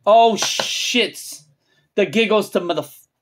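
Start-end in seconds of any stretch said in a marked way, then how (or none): none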